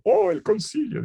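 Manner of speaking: a light, high "Oh" of mock enthusiasm; mock delight. Ratzinger hearing of the council, mildly mock-excited. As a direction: play Ratzinger lightly thrilled at the news. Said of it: "dice Ratzinger".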